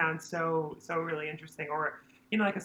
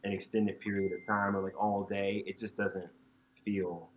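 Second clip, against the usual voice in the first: speaking much lower than usual; low register; 10 semitones lower than usual. Shown as a demonstration. Singer shows his uncomfortable low talking voice. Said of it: "talking in this register".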